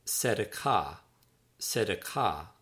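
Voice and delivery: "tzedakah" said as tseh-dah-KAH; careful Hebrew "Tzedakah", tseh-dah-KAH; Hebrew pronunciation. Shown as a demonstration. Author teaching readers a Hebrew word, careful tseh-dah-KAH. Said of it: "that's pronounced".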